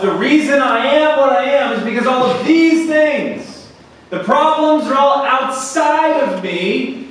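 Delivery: loud challenge climbing into high protest; impassioned pulpit voice; forceful preaching. Acted. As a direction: press the congregation hard, building to an indignant quote.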